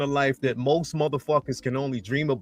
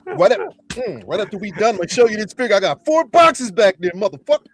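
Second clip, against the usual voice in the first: bragging hype voice; 4 semitones higher, 5 dB louder than usual. Retold or imitated. Imitating Moose bragging about figures.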